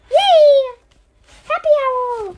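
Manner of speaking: loud, high cheer. Pretend. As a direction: cheer for happy Owl. Story turns happy.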